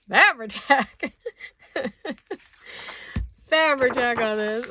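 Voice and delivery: corrects herself through giggles; giggling. Real, not acted. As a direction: laugh through the word. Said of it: "a case of the giggles".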